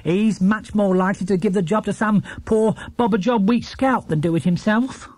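scornful housekeeper. A narrator's reading. Housekeeper scoffing about the lazy neighbour, fast. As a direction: rattle off the doubt dismissively. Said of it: "snorted Missus Bird".

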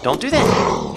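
rising, pleading don't-do-it calls; urgent pleading. Real, not acted. Player begging a zombie not to break the wall, urgent pleading.

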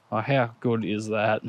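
normal talking voice

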